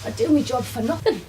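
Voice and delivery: lightly acted earnest younger self; her younger self, high. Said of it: "I say".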